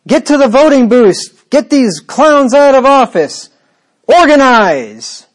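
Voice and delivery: loud, high mock protest call; mock activist shout. Mock political call, not Paul.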